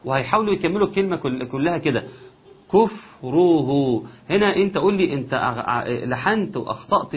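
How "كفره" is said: كفره slowly with lips rounded through the word; rounded dammas. Shown as a demonstration. Teacher showing the lip rounding, slow. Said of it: "الشفتين في الضم".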